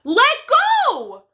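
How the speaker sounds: high, loud shout of the name; a father's loud call to his son; dad yelling. Acted. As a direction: yell it out across the yard. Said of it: "he yelled".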